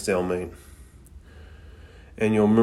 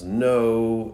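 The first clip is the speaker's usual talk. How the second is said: mild mock yell; 3 semitones higher, 5 dB louder than usual. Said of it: "Tsunami yells".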